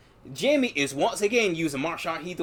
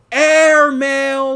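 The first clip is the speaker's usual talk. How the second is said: high, very loud drawn-out scream; screamed battle cry; 9 semitones higher, 13 dB louder than usual. Retold or imitated. Movie fan imitating the mailman who jumps down, screaming. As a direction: belt it out at full volume. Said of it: "he just screams out".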